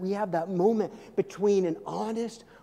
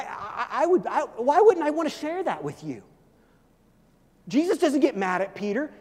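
ordinary talk

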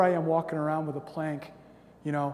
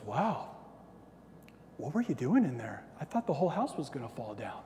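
soft, lower-pitched mock amazement; understated bewildered reaction; quietly stunned; 3 semitones lower, 7 dB quieter than usual. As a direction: imitate a wife's hushed disbelief. Wife's stunned reply, acted.

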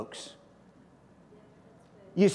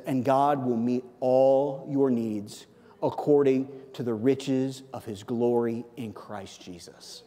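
slow scripture reading; 4 semitones lower, slower than usual. Shown as a demonstration. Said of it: "reads the verse slowly".

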